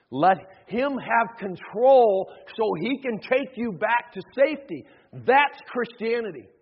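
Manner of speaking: loud, emphatic, slowed sermon climax; impassioned preacher declaring it; forceful preaching. Acted. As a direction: drive each phrase out to the congregation.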